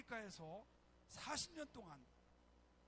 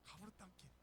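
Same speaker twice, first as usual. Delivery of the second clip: 가부르 땅께 repeated in Jeolla dialect, softer and trailing off; 7 dB quieter than usual. Retold or imitated. Quieter Jeolla dialect echo. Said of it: "전라도 사람들은 뭐라고 합니까".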